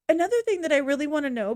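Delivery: normal talking voice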